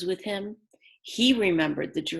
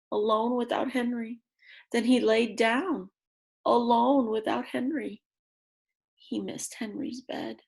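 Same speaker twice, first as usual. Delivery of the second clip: usual speaking voice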